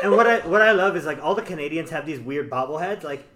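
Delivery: ordinary talk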